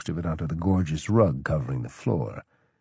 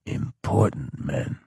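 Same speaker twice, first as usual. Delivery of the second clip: slow, clenched delivery; slow gritted-teeth growl; through gritted teeth; 5 semitones lower, 3 dB quieter, slower than usual. A narrator's reading. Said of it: "He grit his teeth".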